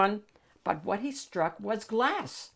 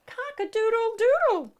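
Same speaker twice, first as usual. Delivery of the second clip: very high, held rooster-like coo; cooing princess; 3 dB louder than usual. Acted. The princess greeting Shrek, very high coo. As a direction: coo it like a lovestruck hen. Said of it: "cooed the princess".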